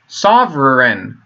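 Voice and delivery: Sovereign drawn out slowly as "sov-ren", just two syllables; slow, deliberate sovereign. Shown as a demonstration. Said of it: "two syllables".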